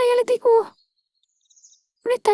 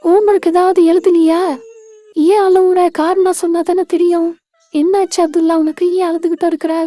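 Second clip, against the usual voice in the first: a concerned big-sister voice, fuller and firmer; 5 semitones lower, 5 dB louder than usual. Acted. Sister asking her crying brother why, concerned big-sister voice. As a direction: call out to him like an older sister.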